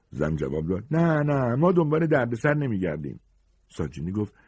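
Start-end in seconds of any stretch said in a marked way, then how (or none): 0.9-3.2 high-pitched, dismissive no; firm refusal. Acted. Suspicious woman refusing a fugitive shelter, high firm refusal. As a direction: turn him away in a high voice.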